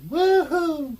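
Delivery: loud joyful holler. Retold or imitated. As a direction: whoop it out like a Duke boy celebrating. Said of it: "give out the woo-hoos".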